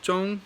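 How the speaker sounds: Zhong held high and level; first tone on Zhong; high flat Zhong. Shown as a demonstration. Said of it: "that means middle".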